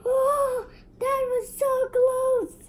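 high loud gasp and cry; high loud breathless relief; relieved scream. Real, not acted. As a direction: shriek with relief after a close call. Narrow escape in game.

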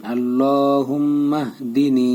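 আল্লাহুম্মাহদিনি slowly, stretching "lo" and final "ni"; measured recitation of আল্লাহুম্মাহদিনি with humming "mm"; madd and ghunnah. Shown as a demonstration. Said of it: "এখানে এক আলিফ টান". (আল্লাহুম্মাহদিনি, টেনে ধীরে তিলাওয়াত)

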